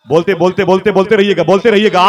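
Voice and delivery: loud, high push to keep the audience chanting; urging the crowd. Real, not acted. Preacher rousing his listeners to keep responding, loud. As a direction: rouse the crowd to keep answering. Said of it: "preacher urging audience to keep responding".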